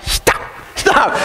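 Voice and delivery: whispered mock echo, repeated; child's mimicking. Son onstage defying his dad, whispered mocking imitation. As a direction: imitate a kid whispering back defiantly. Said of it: "And he's just like".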